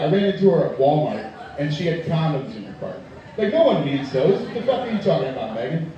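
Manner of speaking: ordinary talk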